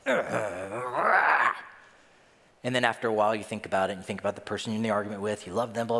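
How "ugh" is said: angry outburst. Acted. Preacher imitates first instinct in an argument, angry growl. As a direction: growl swelling into a mock roar. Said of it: "your first instinct is".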